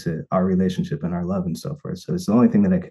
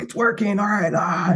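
much higher, light mock triumph; lightly hammed-up relieved glee; retold relief; 13 semitones higher than usual. Seeing her cry at the ring, high and relieved. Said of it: "I'm like".

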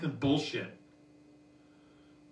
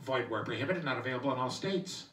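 lower, softer legal disclaimer read; 5 semitones lower, 5 dB quieter than usual. Retold or imitated. Commercial's fine-print disclaimer, quiet announcer read. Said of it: "here's the proof".

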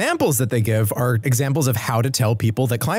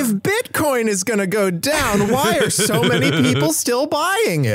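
high-pitched mock bafflement; mock incredulous question; 11 semitones higher than usual. Parodying the video's tough questions, high mock incredulity.

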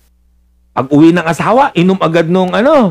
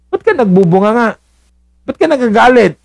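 higher, pleading husband's question; husband asking; 8 semitones higher, faster than usual. Acted. Husband asking his nagging wife why, higher pleading voice. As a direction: ask like a confused husband, a bit fast.

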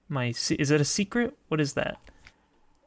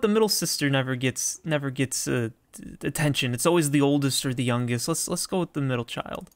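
usual speaking voice

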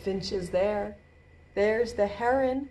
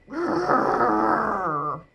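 long rolling growl; drawn-out playful grrr; bear growl. Pretend. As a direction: growl like a bear for small children. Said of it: "And what do they say?".

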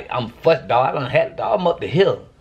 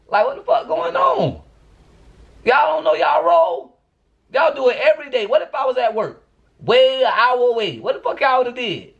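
much higher, fed-up scolding; exasperated rant; 9 semitones higher than usual. Real, not acted. Ranting to viewers about the boys at home, exasperated. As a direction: scold them like they should know better.

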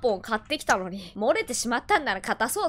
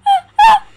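startled scream; 14 dB louder than usual. Real, not acted. Jump scare.

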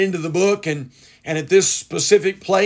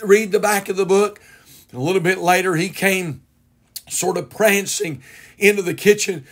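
ordinary delivery

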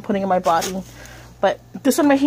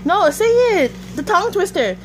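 high urging; 9 semitones higher than usual. Real, not acted. Urging child to repeat.

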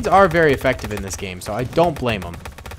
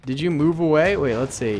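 ordinary talk

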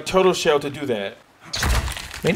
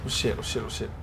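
hushed, tense muttering under the breath; scared hush; 7 semitones lower, 12 dB quieter than usual. Real, not acted. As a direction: keep it low so they don't hear you. Hiding from enemies in game.

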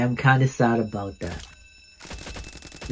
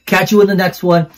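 higher, louder sign-off, Peace shouted; loud outro; 7 semitones higher, 9 dB louder than usual. Real, not acted. YouTuber signing off to viewers, loud excited farewell. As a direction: sign off with a big burst.

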